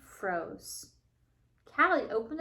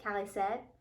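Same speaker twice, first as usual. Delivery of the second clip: ordinary talk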